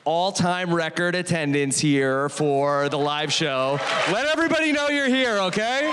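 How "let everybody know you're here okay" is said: hype. Real, not acted. Host hypes live audience.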